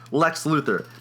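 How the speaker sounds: ordinary talk, nothing marked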